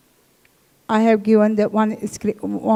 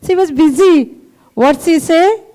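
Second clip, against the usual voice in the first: laughing; 6 semitones higher, 6 dB louder than usual. Real, not acted. Laughs while telling.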